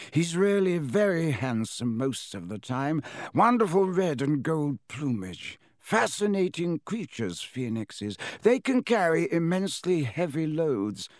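put-on fond, admiring old headmaster; high, slow kindly wizard. Dumbledore voiced.

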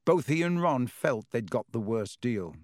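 usual speaking voice

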